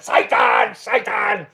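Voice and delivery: loud hammed-up cry of alarm; comic over-the-top shouting; mock horrified shout. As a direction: yell it as if you've seen the devil. Mock cry at seeing therapist.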